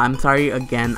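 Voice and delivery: normal talking voice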